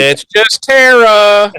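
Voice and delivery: high, loud, drawn-out imitation; hammed-up slow, straining delivery; mock laboured announcement. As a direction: stretch each word high and loud. Mimicking Willow's slow, strained line.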